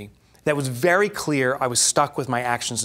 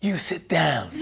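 put-on Godfather accent; 3 semitones higher, slower than usual. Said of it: "accent just like The Godfather".

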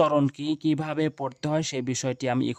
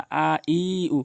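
আ ই উ with each vowel stretched long; drawn-out vowels; slower than usual. Shown as a demonstration. Teacher warning learners against stretching vowels, drawn out. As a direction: drag out আ, ই, উ as the mistake. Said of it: "কখনোই টেনে পড়বেন না".

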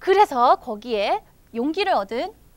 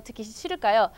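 plain reading voice

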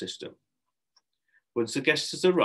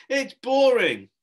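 loud protest; 13 semitones higher, 8 dB louder, slower than usual. A narrator's reading. Harrison keeps shouting refusal.

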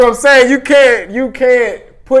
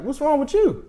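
higher, softer concerned question; inquisitive; 7 semitones higher, 8 dB quieter than usual. Retold or imitated. Checking on the nervous woman, softly inquisitive. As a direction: ask gently, pitched up, imitating curiosity. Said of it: "more of an inquisitive thing".

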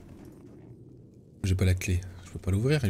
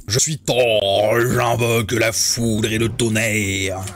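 mock Thor proclamation; much slower than usual.

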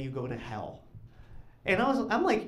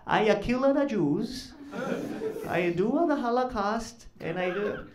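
put-on high, slow, botched German accent; bad German accent; 7 semitones higher, slower than usual. Hitler answers the Devil, badly faked German accent. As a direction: attempt a German accent and miss it. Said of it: "I can't do a German accent".

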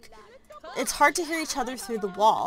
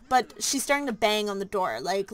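usual speaking voice

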